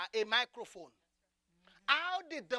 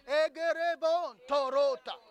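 praying in tongues; 4 semitones higher, 8 dB louder than usual. Real, not acted. Returns to tongues.

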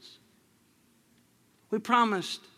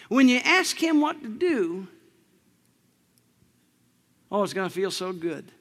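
ordinary delivery

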